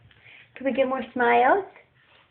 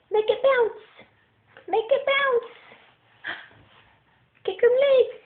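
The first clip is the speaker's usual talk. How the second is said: much higher, coaxing sing-song; 11 semitones higher than usual. Real, not acted. Coaxing baby to kick.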